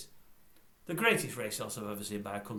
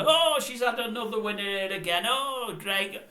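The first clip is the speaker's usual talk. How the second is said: high, loud mock delight; mock gushing presenter; 9 semitones higher, 7 dB louder, slower than usual. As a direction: fake thrill, parroting the presenter. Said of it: "At the end of every race".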